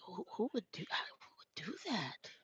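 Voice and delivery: retold disbelief, whispered and slowing to a near sob; hushed, breaking voice of disbelief at how drivers are treated; anguished whisper. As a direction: whisper it like you can't believe people could be this cruel. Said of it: "I'm like".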